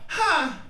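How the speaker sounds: sharp high yelp of sudden pain. Retold or imitated. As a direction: bark a short high cry of pain. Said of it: "first I would yell".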